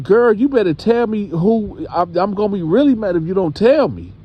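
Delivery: high, threatening grandma voice; mock warning from a stern grandma; hammed-up scolding grandma.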